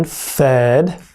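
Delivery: ordinary delivery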